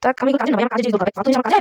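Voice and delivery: normal talking voice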